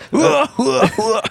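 high-pitched mock laugh, loud; imitated high laugh. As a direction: copy a squeaky laugh from the back. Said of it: "Jetzt habe ich einen hohen Lacher gehört hinten".

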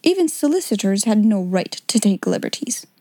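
ordinary delivery, nothing marked

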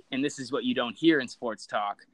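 normal talking voice

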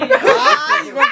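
high laugh. Real, not acted. Friend says drink makes him dumb.

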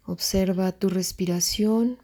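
normal talking voice

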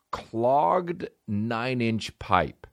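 very slow, drawn-out title; dramatic reading. Real, not acted. Comic rereading the ad title, very slow. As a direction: savor the title slowly. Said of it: "reading title dramatically".

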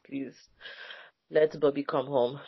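ordinary delivery

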